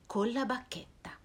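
sussurrato, senza voce; sussurro. A narrator's reading. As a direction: svela il segreto a bassa voce. Narrazione sussurrata. (sussurro della narratrice)

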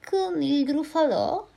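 high, strange snake voice rising in surprise; snake's startled question. Acted. Snake repeating the mouse's words in high surprise. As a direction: echo the name in disbelief, high and thin.

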